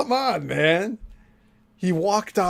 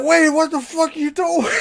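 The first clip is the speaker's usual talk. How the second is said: high, loud incredulous cry; mock shocked shout; 13 semitones higher, 3 dB louder than usual. Everyone reacting as he walks out, mock shocked. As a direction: yell it in disbelief, imitating the room.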